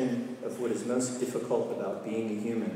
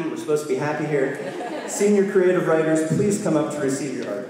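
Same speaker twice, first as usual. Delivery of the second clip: laughing; 5 semitones higher, 7 dB louder than usual. Real, not acted. Laughing off tears, announcing.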